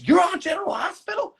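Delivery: high, delighted disbelief; older fan suddenly lit up with surprise; amazed question. Retold or imitated. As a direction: squeal it out like a starstruck fan. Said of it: "And he goes".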